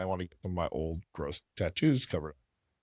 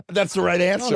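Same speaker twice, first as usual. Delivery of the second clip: high, loud, amused exclamation; amused, laughing; 10 semitones higher, 7 dB louder than usual. Real, not acted. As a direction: say it through laughter, pitched high. Laughing reaction to guest's reply.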